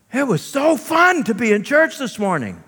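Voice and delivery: high chirpy mock churchgoer; sarcastic mock quote. Mocking churchgoers who never enjoy church, high sarcastic. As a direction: sell the fake enthusiasm. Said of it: "said nobody ever".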